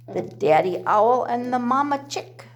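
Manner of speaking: ordinary delivery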